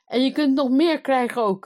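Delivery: loud, high angry threat; calling out. A narrator's reading. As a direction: shout it as a blustering king. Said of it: "riep de koning". (luid en driftig roepend)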